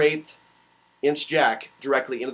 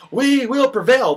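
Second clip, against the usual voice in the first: rallying cry; 9 semitones higher, 6 dB louder, slower than usual. Acted. Rallying freedom speech.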